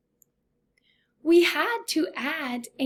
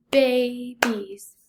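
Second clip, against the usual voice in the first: "Babies" slowly split into two beats; syllable by syllable; 4 dB louder than usual. Shown as a demonstration. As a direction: sound out "Babies" as bay, beez. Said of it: "This word has two syllables".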